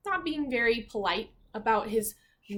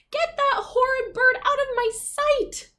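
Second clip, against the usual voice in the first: much higher, louder shriek of disgust; screaming princess; 9 dB louder than usual. Acted. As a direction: scream it at the guards. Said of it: "she screamed".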